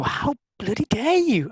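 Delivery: high, slow, outraged How bloody dare you; mock fury at a friend; drawn-out indignant cry; indignant outburst. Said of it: "And I would go".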